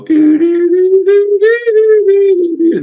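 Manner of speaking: very high, loud recorder-like tootling; recorder imitation. Somebody picking up an instrument to play, high recorder imitation. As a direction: toot out high notes like a recorder. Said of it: "make it sound like a recorder".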